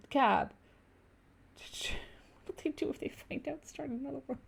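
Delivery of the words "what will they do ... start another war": hushed, whispered aside; playful stage whisper; mock whisper. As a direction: whisper it like a secret. Mock-worried aside amid joking.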